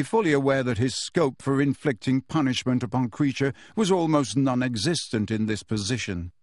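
usual speaking voice

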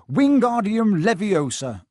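loud, slow shouted spell; shouting spell. Acted. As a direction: bellow the spell, arms flailing. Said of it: "he shouted".

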